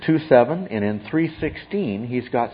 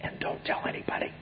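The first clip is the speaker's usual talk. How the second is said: whispered, as if sharing a forbidden secret; 15 dB quieter than usual. Acted. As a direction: hush it like a secret warning. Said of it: "the Gnostic teachers who would say".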